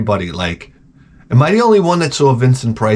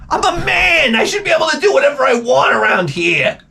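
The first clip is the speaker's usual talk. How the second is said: very high-pitched mock tantrum yell; indignant shout. Husband protesting to his wife, indignant shouting. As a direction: holler it in comic outrage, voice way up.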